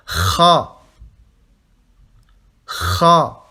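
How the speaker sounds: Kha scraped in the throat, loud; roaring kha. Shown as a demonstration. Teacher repeating Kha for students, roaring air. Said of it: "en rugissant".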